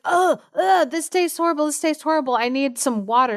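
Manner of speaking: high, mock-disgusted groan; disgusted Monkey. Monkey biting the bitter bug, high mock disgust. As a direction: pull a face at the bitter taste, pitched high.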